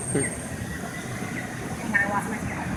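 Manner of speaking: hushed, near-whispered aside to self; muttered aside. Real, not acted. Speaker loses their place mid-speech, hushed aside. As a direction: drop right down to a quick private murmur.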